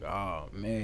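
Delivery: soft, low dread-filled aside; muttered inner thought. Retold or imitated. Narrator expecting cousin to cause a scene, quiet inner aside. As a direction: drop right down as if thinking to yourself. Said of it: "I'm like".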